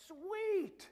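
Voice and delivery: high, loud gleeful "Sweet!"; mock greedy delight. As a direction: play the greedy believer cheering. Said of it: "if you're thinking".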